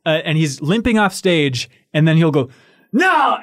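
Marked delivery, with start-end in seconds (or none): 2.9-3.4 high-pitched theatrical outburst; sudden hammed-up exclamation, pitched way up; mock defiant cry. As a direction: imitate a performer suddenly refusing to quit. Acts out singer's sudden comeback cry.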